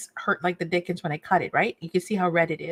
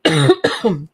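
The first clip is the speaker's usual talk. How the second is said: coughing, choked up; words through a cough; choking; 5 semitones higher, 4 dB louder, much slower than usual. Real, not acted. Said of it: "I was choked".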